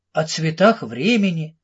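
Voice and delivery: ordinary delivery, nothing marked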